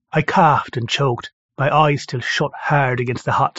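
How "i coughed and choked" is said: ordinary delivery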